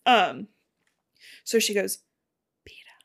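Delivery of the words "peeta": hushed, slow, barely audible call; whispered call. Acted. Girl searching for injured Peeta, whispered call. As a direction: whisper the name out cautiously. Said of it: "she whispers".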